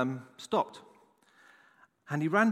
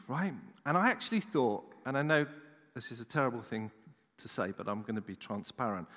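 ordinary talk

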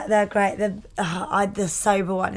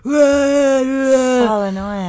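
"So annoying" stretched very slow and louder; drawn-out groan; 5 semitones higher, 7 dB louder, much slower than usual. Real, not acted. Agreeing about drunk passengers, long exasperated groan. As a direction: drag it out in exasperation.

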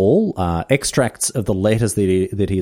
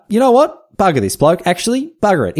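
higher, louder exasperated outburst; mock fed-up outburst; 9 semitones higher, 4 dB louder than usual. Host gives up on Darwin after his farewell insult, in a loud mock-exasperated outburst. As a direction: throw up your hands at Darwin.

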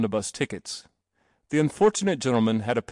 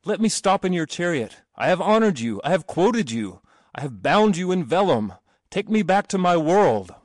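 high, raised desperate plea; crying out; 7 semitones higher, 4 dB louder than usual. A narrator's reading. Terrified passenger begging the driver, crying out. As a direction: beg for rescue in a high, urgent voice. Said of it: "he cried to the driver".

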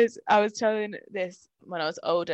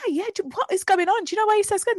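worried parents; faster than usual. Retold or imitated. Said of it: "his parents are like".

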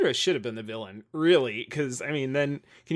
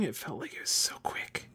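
whisper; 6 semitones lower, 10 dB quieter than usual. Real, not acted. Aside under the talk.

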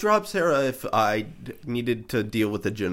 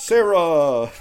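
loud, high, drawn-out cry of a name; mock dramatic call; 8 semitones higher, 7 dB louder than usual. Co-host playing John calls to Sarah, hammed-up loud cry.

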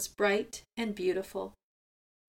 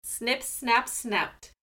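story closing; slower than usual. A narrator's reading. Closing formula.

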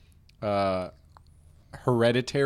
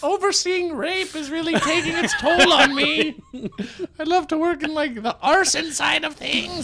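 high, louder demon voice; 6 dB louder than usual. Pretend. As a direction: squeal it like a cartoon demon. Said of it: "good demon voice".

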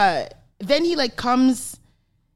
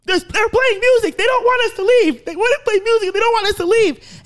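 much higher, louder eager voice; excited friend; 12 semitones higher, 4 dB louder than usual. Retold or imitated. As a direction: insist eagerly they should stay. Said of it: "Tresor is like".

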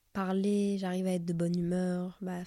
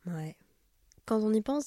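ordinary delivery

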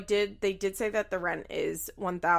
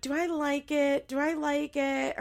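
higher-pitched mimicked dithering; wavering; 7 semitones higher than usual. Imitating a daughter unsure about the townhouse, wavering. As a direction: imitate someone going back and forth, pitched up.

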